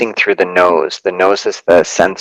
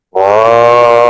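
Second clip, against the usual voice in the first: louder breathy whoa on exhale; loud exhaled whoa; 4 semitones higher, 5 dB louder than usual. Shown as a demonstration.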